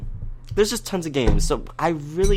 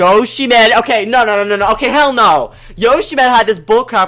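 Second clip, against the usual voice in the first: loud, high outraged yelling; real frustrated shouting at a friend; exasperated shout; 8 semitones higher, 8 dB louder than usual. Real, not acted. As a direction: blow up at him in disbelief. Riled up at rival's cheating.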